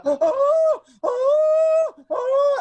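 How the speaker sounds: very high, loud shrieking oh; little girl scream. Retold or imitated. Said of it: "screaming like a five-year-old little girl".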